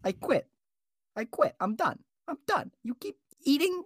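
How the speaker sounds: hammed-up frustrated host quitting; mock quitting. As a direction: storm off in mock fury. Host quits over eating.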